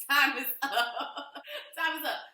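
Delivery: loud laughing announcement. Real, not acted. Laughing, ending mock pause.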